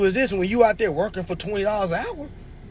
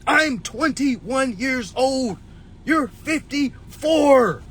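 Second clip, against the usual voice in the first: slow emphatic; 5 semitones higher, much slower than usual. Retold or imitated. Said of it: "I'm like".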